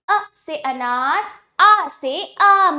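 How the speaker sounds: slow, drawn-out vowel drill; alphabet recitation. Shown as a demonstration. Teacher leading children through letters, slow recitation. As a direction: recite अ short and आ long, slowly. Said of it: "अब इसको एक साथ पढ़ते हैं".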